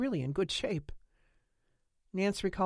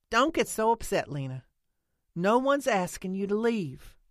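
louder, higher, slowing to reassure; insistent soothing; 5 semitones higher, 7 dB louder than usual. A narrator's reading. Nance telling Lena not to get upset, insistent. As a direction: settle her down firmly.

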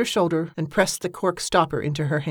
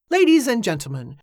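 higher, louder ceremonial call to the crowd; grand announcement; 6 semitones higher, 3 dB louder than usual. Acted. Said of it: "I give you Evangeline Murray".

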